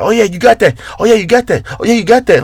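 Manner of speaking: high, hammed-up hype-man cheering every rep; mock gym hype cheer. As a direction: overdo the fake praise like a gym bro. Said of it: "But I'm not gonna be like".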